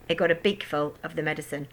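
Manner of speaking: plain reading voice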